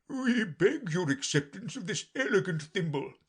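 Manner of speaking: high, ceremonious character voice; solemn Dodo. A narrator's reading. Dodo presenting Alice the thimble, solemn and high. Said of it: "solemnly presented the thimble".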